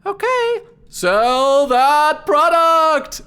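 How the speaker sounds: very high, loud creature voice; Flea's voice. Acted. Flea tastes the foam and recites the pitch, very high voice. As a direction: talk way up high like a tiny creature. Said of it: "And he says".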